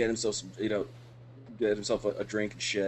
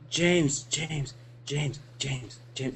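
pestering, repeated mock-drunk name calls; imitating drunk friend; 4 semitones higher, 5 dB quieter, much slower than usual. Friend tapping his shoulder for attention, mock drunk pestering. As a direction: nag for attention like a tipsy friend. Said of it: "you were so fucking drunk".

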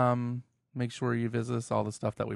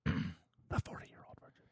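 whisper; 4 semitones lower, 8 dB quieter, slower than usual. Real, not acted. Signing off the episode.

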